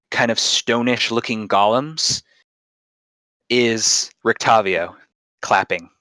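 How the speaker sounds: slow, suspenseful scene setting; cliffhanger ending. Acted. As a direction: draw out each beat for suspense. DM sets dramatic scene.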